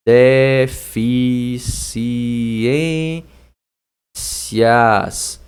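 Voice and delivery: "deficiências" hugely drawn out, DE-FI-CI-ÊN-CIAS; very slow dictation of "deficiências"; syllable by syllable. Shown as a demonstration. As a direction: stretch every syllable of "deficiências" with gaps. Teacher splits word into syllables.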